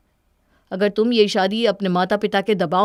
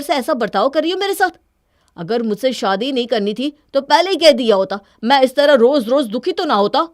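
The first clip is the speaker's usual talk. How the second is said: louder and higher, shouting at wife; angry shout; 6 semitones higher, 6 dB louder than usual. Acted. Husband shouting at his wife over the money, angry and loud. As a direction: hurl the hurt back at her.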